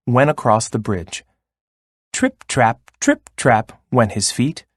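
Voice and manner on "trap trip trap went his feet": ordinary delivery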